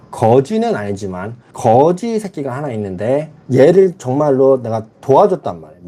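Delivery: normal talking voice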